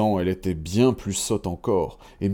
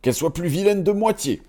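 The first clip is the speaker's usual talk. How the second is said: dwarf curse; 9 semitones higher, faster than usual. A narrator's reading. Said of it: "dit un second".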